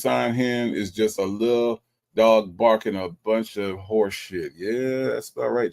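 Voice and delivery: ordinary talk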